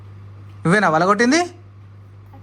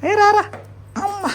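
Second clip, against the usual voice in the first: high calling out. Real, not acted. Calling child to come.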